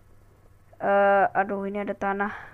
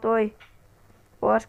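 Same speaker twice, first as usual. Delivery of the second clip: ordinary talk, nothing marked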